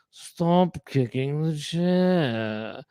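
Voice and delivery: very slow, drawn-out put-on voice; grumpy passenger. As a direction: stretch out the grumpy order. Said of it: "and he went".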